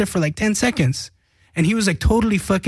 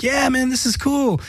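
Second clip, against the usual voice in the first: higher, slower gushing "this is cool"; mock delighted; 7 semitones higher than usual. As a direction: savor the moment, buzzing. Band's delighted reaction.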